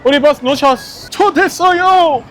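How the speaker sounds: high, loud alarm at missed bus; panicked shouting. Real, not acted.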